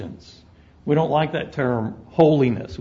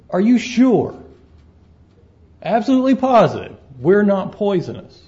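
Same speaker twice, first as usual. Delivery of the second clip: higher and louder, pleading snake; anxious snake; 5 semitones higher, 4 dB louder than usual. Acted. Worried snake begs his friend for reassurance, higher and louder. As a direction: press him hard, fretting for sure.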